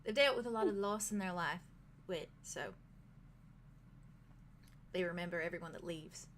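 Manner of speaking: soft, quiet British innkeeper voice; British accent. Acted. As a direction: speak gently in a British lilt. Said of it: "slip into a British accent".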